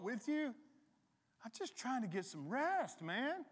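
high-pitched, incredulous complaint; Jesus. Acted. Woken man to his scared followers, high exasperated disbelief. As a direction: protest in a high, put-upon voice. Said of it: "And he's like".

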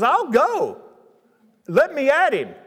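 raring to go, let me at him; eager boy. Retold or imitated. Young David volunteering to fight Goliath, eager.

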